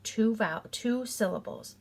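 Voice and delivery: ordinary delivery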